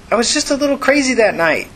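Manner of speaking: high-pitched defensive yell; yelling back. Acted. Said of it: "Tina yelled back".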